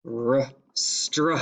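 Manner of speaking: "str-" sounded alone, cut off; isolating the blend. Shown as a demonstration. Teacher isolating the first part "str-". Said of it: "Let's listen close to the first part".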